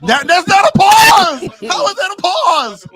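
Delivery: high, loud objection; outraged protest; indignant shout. Real, not acted. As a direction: yell it, refusing the call. Protesting the pause call.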